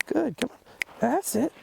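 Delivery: high, bright dog-praise voice. Real, not acted. Encouraging the dog.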